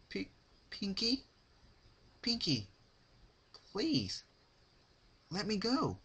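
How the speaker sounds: high, trembling pleading pony voice; Rarity pleading. Acted. Rarity begging Pinkie to let her go, high pleading. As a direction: beg for release in a high voice.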